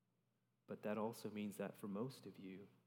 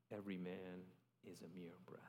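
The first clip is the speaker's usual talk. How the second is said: whisper; 3 semitones lower, 6 dB quieter than usual. Acted. Closing line of scripture reading.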